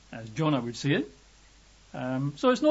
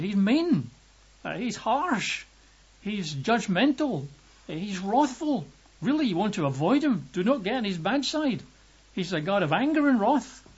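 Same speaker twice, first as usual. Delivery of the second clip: higher-pitched, emphatic list of charges; disapproving critics; 6 semitones higher than usual. Retold or imitated. Preacher voices people who fear the Old Testament God, disapproving. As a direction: imitate people warning others off, pitch raised on each charge.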